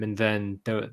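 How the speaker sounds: normal talking voice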